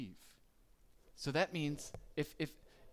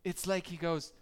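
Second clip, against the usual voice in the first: usual speaking voice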